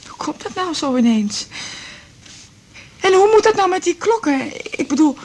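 fretting, near panic; distraught outburst. Acted. Widow anxiously asking her daughter about the clocks.

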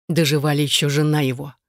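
ordinary delivery

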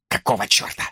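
low, whispered snarl; tense whispered curse; harsh whisper. A narrator's reading. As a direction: hiss it under your breath. Wallace hissing at Brook.

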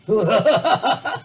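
put-on loud old man's guffaw; hearty laugh. A narrator's reading. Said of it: "cười kha khả".

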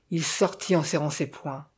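usual speaking voice

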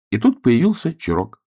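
ordinary delivery, nothing marked

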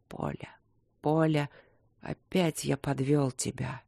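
soft, understated self-reproach; weary regret. A narrator's reading. Wounded king speaks to his absent wife, weary regret. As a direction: murmur it as a weak apology.

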